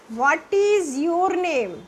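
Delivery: What is your name stretched very slowly. Shown as a demonstration. Teacher models a question pupils will be asked, very slow. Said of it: "कोई भी आपसे पूछे".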